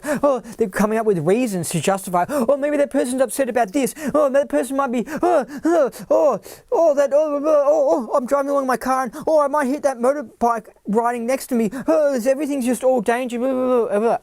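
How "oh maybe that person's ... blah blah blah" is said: much higher, hammed-up anxious chatter; mock paranoia. Mocking fearful people imagining danger, high panic. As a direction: spiral into mock panic over every danger. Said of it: "they walk around in life like".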